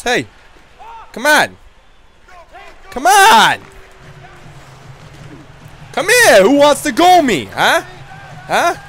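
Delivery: loud, high taunting calls to fight; taunting shout. Pretend. Hockey enforcer shouting to rivals, loud taunting. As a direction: bark challenges at the other team.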